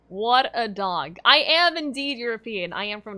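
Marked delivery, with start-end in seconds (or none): 0.1-1.2 louder and slow, drawn out; slow emphatic declaration. Real, not acted. Streamer proclaiming to chat about her dog, loud and slow. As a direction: proclaim it with mock pride. Repeats phrase, differently.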